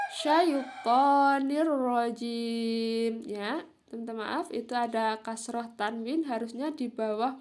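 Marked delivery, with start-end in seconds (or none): 0.1-3.2 SYAITONIR RAJIM very slow, long vowels and doubled r; recitation example. Shown as a demonstration. Teacher reciting the fourth example, slow and merged. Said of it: "Contoh keempat".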